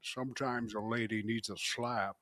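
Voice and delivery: low, soft mock Scottish accent; Sean Connery. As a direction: do a hushed, low Scottish impression. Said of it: "He's doing a Sean Connery on a chick".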